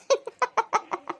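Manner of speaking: high, soft chuckling laugh; laughing at a silly question; amused laugh. Acted. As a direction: burst into a light, teasing laugh. Mother laughs at daughter's adoption question.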